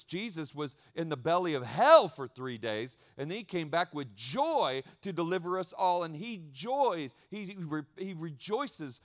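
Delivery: rising preacher fervor, slowing on rejoices; preaching it. Real, not acted. Pastor preaching the resurrection to the church with fervor. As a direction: build it up like a revival preacher. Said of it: "See, I'm preaching it".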